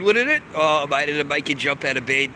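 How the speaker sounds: ordinary delivery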